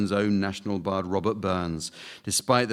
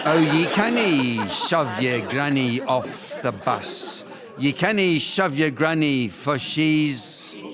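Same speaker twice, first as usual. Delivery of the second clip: emphatic rhyme prompt; 3 semitones higher, slower than usual. Shown as a demonstration. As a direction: belt out the rhyme slowly, pitched up. Host repeats the rhyme for Andy.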